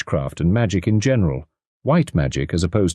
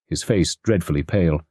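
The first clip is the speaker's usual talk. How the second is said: usual speaking voice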